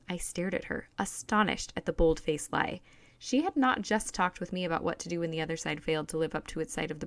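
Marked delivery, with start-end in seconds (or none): none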